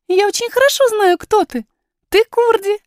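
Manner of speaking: put-on high girlish voice, sure of herself; high, assured child's voice; confident young princess. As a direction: pipe up eagerly as a little princess. Princess's figure in the story.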